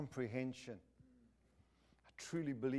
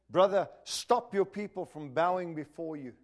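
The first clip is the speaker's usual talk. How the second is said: stern rebuke; 4 semitones higher, 8 dB louder than usual. Retold or imitated. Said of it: "I said".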